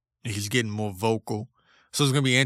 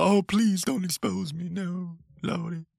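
hammed-up begging, much higher; mock pleading; 7 semitones higher than usual. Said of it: "other people that are just".

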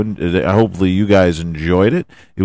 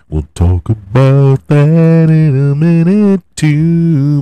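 slow, louder and higher proclamation; big announcer retake; 7 semitones higher, 10 dB louder, slower than usual. Shown as a demonstration. Promising the NBA talk to listeners, booming. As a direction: boom it out slowly like a promo. Repeats line two ways.

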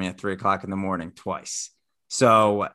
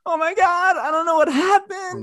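imitating a very high, panicked friend; louder mock-frantic wail on the phone; frazzled; 5 dB louder than usual. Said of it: "sounded frazzled".

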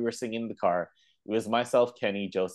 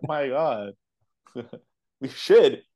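ordinary delivery

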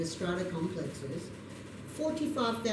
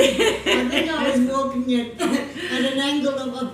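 laughing through the words; 6 semitones higher, 7 dB louder than usual. Real, not acted. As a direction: chuckle while you tell it. Laughing while telling the storm.

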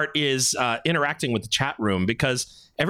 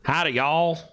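"Y'all" in a Californian accent; California accent; slower than usual. Shown as a demonstration. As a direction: demonstrate a Californian's "Howdy, y'all". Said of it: "It sounds funny with a California accent".

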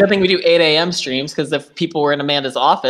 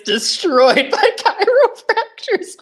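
high, slow, laughing read; laughing title read; 10 semitones higher, slower than usual. Retold or imitated. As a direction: savor the title, cracking up. Reads title slowly, laughing.

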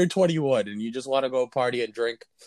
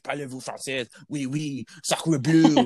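mock French accent, hammed up; french chef. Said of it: "They're speaking French".

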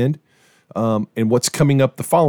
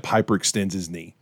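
ordinary delivery

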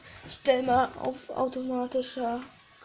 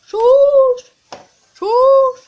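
shouted, much higher and louder; 12 semitones higher, 20 dB louder than usual. Real, not acted. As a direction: yell it out in frustration. Shouting at fight outcome. (lauter Ausruf)

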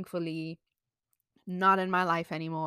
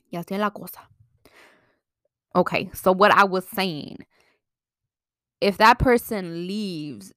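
ordinary talk, nothing marked

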